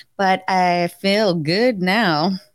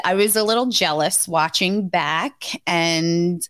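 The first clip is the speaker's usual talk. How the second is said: normal talking voice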